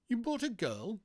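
high-pitched incredulous question; appalled disbelief. Acted. Bee appalled at a girl.

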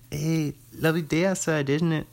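hammed-up British small talk, tagged like a question; badly faked British voice; mock British accent. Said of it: "that's how you do a British accent".

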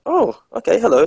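mock surprise, pitched well up; pleasantly surprised. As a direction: act out a pleasant little surprise. Pleasant surprise at actors' casting.

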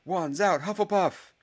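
high-pitched rallying yell; shout. A narrator's reading. Ernie rallies his house, high shouting. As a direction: build into a loud battle cry. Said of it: "shouted Ernie".

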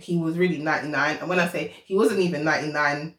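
ordinary talk

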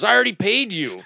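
mock-angry, voice pushed up high. Friend retelling Clint snapping about the pay, mock angry. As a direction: snap it back like an annoyed friend. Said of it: "Did Did he raise his voice like that?".

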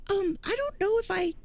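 put-on high, innocent little-kid voice; child voice. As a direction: squeak it out like a kid pretending. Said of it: "stuffed animal says".

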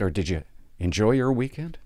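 usual speaking voice

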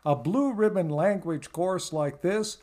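folksy, booming radio ad pitch; ad announcer. Acted. Announcer pitching a language course to cowboys, booming ad read. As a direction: sell it like a late-night cowboy commercial.

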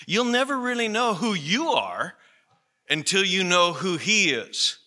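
high, earnest preacher's plea; preaching emphasis. Real, not acted. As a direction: drive the point home to the congregation. Preaching climax.

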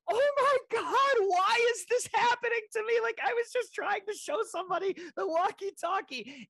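very high falsetto wail, hammed-up despair; mock panicked squeal. To herself while the line's muted, high mock wail. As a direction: shriek it like a cartoon in a crisis. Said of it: "I'm just sitting there like".

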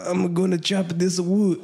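slow, put-on Spanish accent as grandpa; grandpa's words in a Spanish accent. Retelling grandpa chopping wood, in a Spanish accent. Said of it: "my best, uh, Spanish accent".

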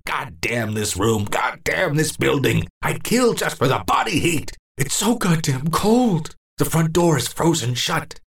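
put-on old man swearing at winter; bitter cursing. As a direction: curse the freezing cold.

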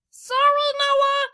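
very high, loud character shout; screamed apology. A narrator's reading. Kenzo abandoning Noah, high scream. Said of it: "screamed Kenzo".

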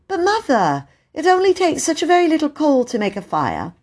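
put-on high, coaxing child voice; pleading child. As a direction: wheedle like a little girl.

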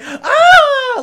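high, loud scream. Retold or imitated. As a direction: shriek in panic. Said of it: "they're like".

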